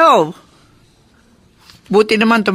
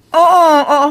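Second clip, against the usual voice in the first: louder, higher quick yes; urgent agreement; 6 semitones higher, 5 dB louder than usual. Acted. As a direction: agree in a rush. (nagmamadaling pagsang-ayon)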